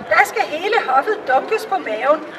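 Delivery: usual speaking voice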